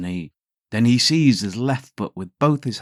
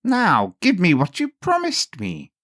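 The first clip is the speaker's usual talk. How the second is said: high, louder imp demanding his due; little man demanding; 11 semitones higher, 5 dB louder than usual. A narrator's reading. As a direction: claim the child with glee. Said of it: "he came suddenly ... and said".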